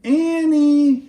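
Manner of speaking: dad calling, high and loud; dad calling the kids in from the porch. Acted. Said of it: "he called".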